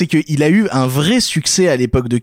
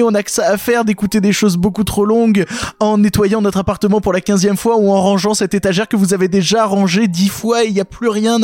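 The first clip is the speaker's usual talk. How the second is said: podcast outro; 5 semitones higher than usual. Acted. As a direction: wrap up the show grandly. Formal show outro.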